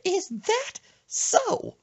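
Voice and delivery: very high, slow, drawn-out skeptical question; grandma's slow high voice. Retold or imitated. Grandma doubting her grandson, very high and slow.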